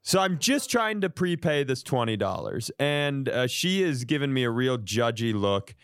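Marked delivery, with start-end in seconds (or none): none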